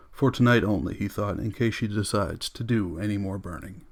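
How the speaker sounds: plain reading voice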